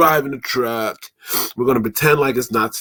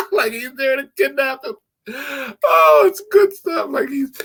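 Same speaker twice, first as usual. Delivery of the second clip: much higher, laughing while speaking; talking through laughter; 4 dB louder than usual. Real, not acted. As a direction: get the words out through laughter. Still laughing at own joke.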